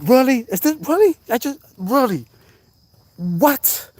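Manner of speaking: high incredulous "really?"; stunned, can't believe it; disbelief. Retold or imitated. To viewers, reliving the storm in disbelief. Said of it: "And I'm like".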